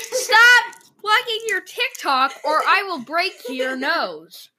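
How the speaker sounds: slow, high, loud barbarian threat. Acted. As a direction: bellow it like a brute warrior.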